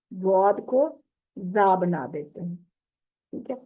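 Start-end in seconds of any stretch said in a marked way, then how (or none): none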